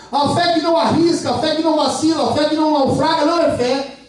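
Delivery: ordinary talk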